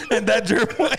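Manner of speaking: high, helpless laughing voice; words squeezed out through laughter; laughing while talking. Real, not acted. Telling of yet another dead gerbil, cracking up.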